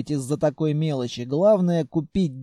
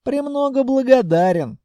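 high, louder, smiling politeness; exaggerated courtesy; 9 semitones higher, 6 dB louder than usual. A narrator's reading. Buyer thanks rude Khalya, as amiably as possible. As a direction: pour on the politeness with a smile. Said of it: "улыбнулся ей как можно любезнее".

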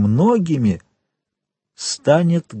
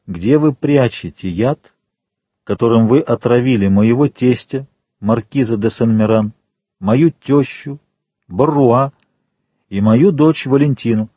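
ordinary delivery

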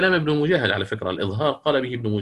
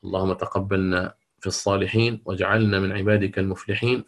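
lower, steady supplication voice; prayer; 4 semitones lower than usual. Acted. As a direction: close the lesson with a calm prayer. Closing supplication. (دعاء ختام الدرس بصوت هادئ)

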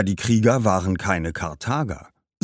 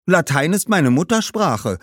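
higher schoolboy voice; explaining boy; 8 semitones higher, 4 dB louder than usual. Acted. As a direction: explain brightly as a boy, pitched up. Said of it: "erklärte Augustinus". (erklärender Junge, höhere Stimme)

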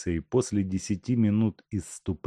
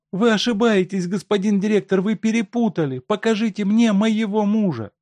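high, loud agitated wife voice; wife insisting; 14 semitones higher, 6 dB louder than usual. A narrator's reading. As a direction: demand to see her husband. Wife argues with director.

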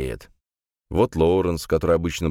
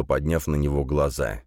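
usual speaking voice

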